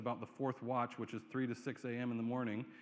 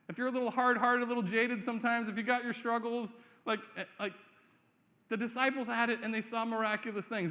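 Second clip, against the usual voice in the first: louder, higher impassioned sermon delivery; impassioned pulpit preaching; full-force preaching; 7 semitones higher, 4 dB louder than usual. Real, not acted. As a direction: drive the point home to the congregation.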